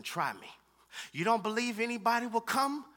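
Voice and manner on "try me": lower, softer, calm challenge; God's quiet reply. Retold or imitated. Pastor voicing God's answer to him, quiet and calm. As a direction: say it low and steady, like a dare. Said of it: "and God is saying".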